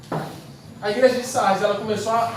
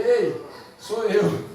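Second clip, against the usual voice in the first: drawn-out retold answer; much slower than usual. Jesus answers the frightened disciple.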